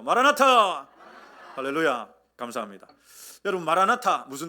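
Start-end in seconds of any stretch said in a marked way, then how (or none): 0.0-0.9 rousing Maranatha shout; loud greeting cry. Shown as a demonstration. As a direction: call it out loud and higher. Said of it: "저하고도 한번 하시죠".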